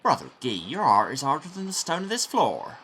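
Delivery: lightly voiced grumpy monk reproach; grumpy old monk. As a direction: chide him like a cranky elder monk. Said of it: "He has a grumpy old monk".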